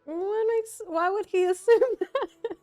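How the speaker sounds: high, loud, laughing question; amused disbelief. Real, not acted. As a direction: laugh in real bafflement as you ask. Amused disbelief at the game line.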